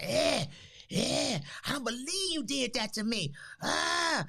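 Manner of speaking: high, betrayed mother's cry; mock outrage. Daughter imitating mother's mock-poisoned outrage over the greens. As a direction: act poisoned and wronged, high pitched. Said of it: "She act like I had poisoned her".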